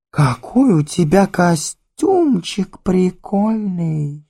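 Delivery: high, slow, gushing socialite drawl; mannered drawl. Acted. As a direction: stretch each word like a glamour girl. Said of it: "очень манерно разговаривает".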